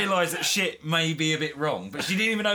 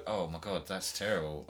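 much softer and lower, mock dismay; mock horrified parent; 9 semitones lower, 7 dB quieter than usual. Parent before the police, mock quiet horror. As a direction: hush it like a shocked parent. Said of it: "Are you gonna go".

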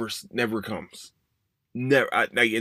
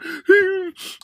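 high, loud comic whimper; mock crying wail; 7 dB louder than usual. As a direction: squeal out a fake little sob. Imitates one little cry.

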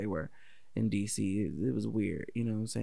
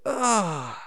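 higher, louder disgusted groan; exasperated groan; 8 semitones higher, 5 dB louder than usual. Retold or imitated. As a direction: let out a fed-up coach's groan. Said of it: "him pulling off his hat and go".

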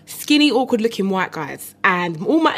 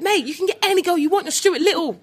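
hyped-up encouragement; 10 semitones higher than usual. Retold or imitated. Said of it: "I was like".

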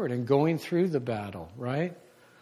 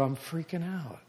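hushed confession; 11 dB quieter than usual. Retold or imitated. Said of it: "And I go".

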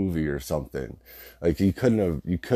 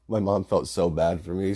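ordinary talk, nothing marked

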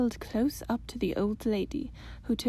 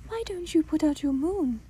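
cartoon princess; 5 semitones higher, 4 dB louder than usual. Princess asks grandmother.

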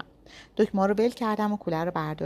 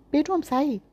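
shout; 7 semitones higher than usual. A narrator's reading. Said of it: "سهراب داد زد".

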